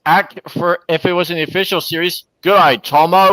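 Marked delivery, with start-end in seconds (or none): 2.4-3.3 friendly Australian g'day, louder; Aussie greeting. Shown as a demonstration. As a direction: say it like a real Aussie.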